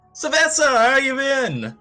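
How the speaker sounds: loud, high, drawn-out hello; stretched-out, exuberant shout; hearty greeting call. Acted. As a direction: holler to an old friend across the room. Character greets friend from the door.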